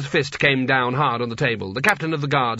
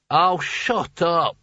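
talking hat; 5 semitones higher, much slower than usual. A narrator's reading. Hat snaps, slow odd voice.

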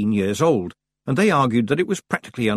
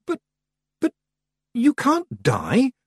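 put-on high, halting girlish protest; slow, high-pitched, shocked objection; bursting out; slower than usual. A narrator's reading. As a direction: stammer it out in disbelief. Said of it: "Tiffany burst out".

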